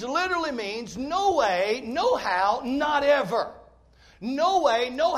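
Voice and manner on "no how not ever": ordinary talk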